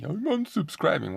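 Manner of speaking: high-pitched mock of a complainer; put-on high voice, making fun; mocking imitation. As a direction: mimic a huffy commenter in a high voice. Mimicking commenters who threaten to leave.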